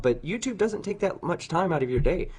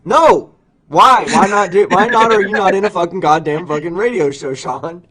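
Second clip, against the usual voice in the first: loud, high-pitched exasperated outburst; heated yelling that eases off on the last word; exasperated shouting; 6 semitones higher, 10 dB louder than usual. Real, not acted. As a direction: shout over him, demanding an answer. Exasperated demand for an answer.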